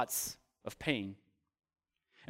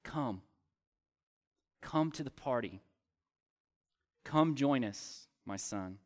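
softer and lower, a gentle coaxing invitation; tender call; 3 semitones lower, 5 dB quieter than usual. Retold or imitated. Father inviting sulking older son to party, soft and tender. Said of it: "he calls out to his older son".

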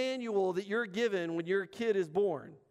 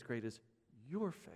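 much lower and softer, a near hush; tender praise; 9 semitones lower, 10 dB quieter than usual. Acted. Jesus looking at the woman, hushed tender praise. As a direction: praise her gently, almost under the breath. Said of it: "looks at this woman and says".

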